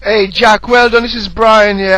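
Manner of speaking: ordinary delivery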